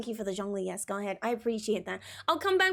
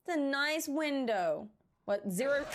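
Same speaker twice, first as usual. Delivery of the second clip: usual speaking voice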